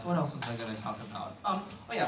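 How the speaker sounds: ordinary delivery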